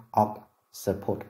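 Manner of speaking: occiput very slowly, split into syllables; slow teaching demo of "occiput", broken into syllables; syllable-by-syllable pronunciation. Shown as a demonstration. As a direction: sound out "occiput" slowly, piece by piece. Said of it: "Từ đầu tiên".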